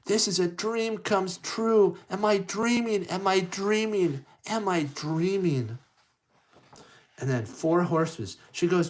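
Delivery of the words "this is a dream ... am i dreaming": high-pitched, dreamy wonder; amazed Cinderella. Acted. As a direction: marvel in a high heroine voice, repeating in disbelief. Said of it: "And so she's like".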